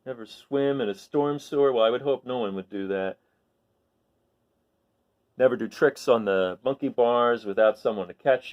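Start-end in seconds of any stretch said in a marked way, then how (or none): none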